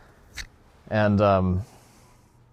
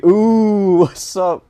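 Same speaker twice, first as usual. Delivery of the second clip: mock taunt; 12 semitones higher, 12 dB louder, much slower than usual. Teasing roast of a friend.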